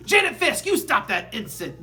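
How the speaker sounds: sharp, high, loud scolding; stern pounce. A narrator's reading. Teacher pouncing on the wrong student, loud stern scold. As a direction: snap at the child like a hawk. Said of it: "Miss Avery pounced".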